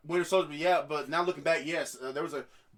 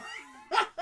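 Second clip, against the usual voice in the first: high breathy laugh; 13 semitones higher than usual. Real, not acted. Laugh after joke.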